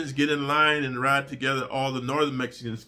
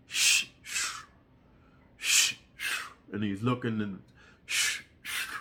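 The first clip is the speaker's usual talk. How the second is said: unvoiced shh scraping noise; soft sharpening sound; imitated scraping; 6 semitones lower, 5 dB quieter, slower than usual. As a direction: hiss out the scrape of the blade.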